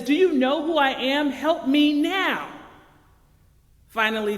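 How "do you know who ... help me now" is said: irate traveler shouting at the gate agent. A narrator's reading. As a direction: bark it out in full rage, stretching help me now. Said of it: "the man, now irate".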